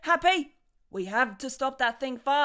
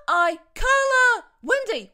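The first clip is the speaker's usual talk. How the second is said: relieved cry; 9 semitones higher, 5 dB louder than usual. Acted. Waking, calling out to friend.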